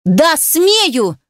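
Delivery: high-pitched defiant cry, moderate volume; shouting back. A narrator's reading. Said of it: "закричала она".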